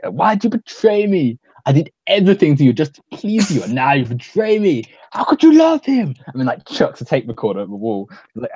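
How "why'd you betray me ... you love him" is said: hurt Johnny impression. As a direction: overplay the betrayed lover. Johnny confronting Lisa.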